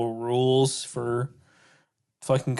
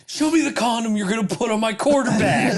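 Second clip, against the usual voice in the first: higher, laughing jock line; quoting Thad; 7 semitones higher than usual. Retold or imitated. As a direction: toss off the jock's line with a laugh.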